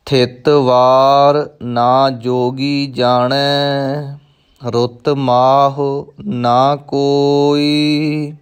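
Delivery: slow, measured recitation with drawn-out syllables; scripture recitation. Shown as a demonstration. Teacher models a scripture line for learners, slow recitation. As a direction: recite each word slowly and evenly.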